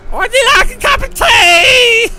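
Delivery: very high, loud shrieking put-on voice; mock shrill wife voice. Mocking the wife offering tea, very high and loud. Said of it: "she's like".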